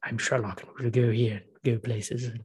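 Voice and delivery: mocking a bad English accent in a film; Sherlock mock English accent. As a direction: ham up a posh detective's English accent. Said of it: "English accent in Sherlock".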